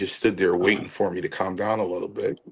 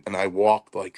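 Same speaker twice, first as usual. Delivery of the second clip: ordinary talk, nothing marked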